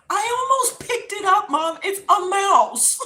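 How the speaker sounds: high, loud panic; freaking out. Retold or imitated. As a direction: reenact frantic horror, dragging out the end. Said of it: "I'm freaking out".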